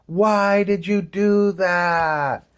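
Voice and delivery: high, very slow exaggerated scolding question; stern adult questioning. Adult confronting a kid after a dangerous stunt, slow scolding question. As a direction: draw it out like a shocked grown-up demanding answers.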